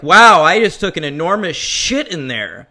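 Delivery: loud, hammed-up Ace Ventura bravado; Ace Ventura impression. Retelling Shrek's opening to a friend, loud Ace Ventura voice. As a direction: belt it out like a cocky pet detective. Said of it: "doing an old Ace Ventura".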